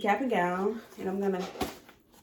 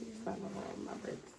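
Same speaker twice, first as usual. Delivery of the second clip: muttered whisper; 2 semitones higher, 15 dB quieter, faster than usual. Real, not acted. Cap won't fit, muttering.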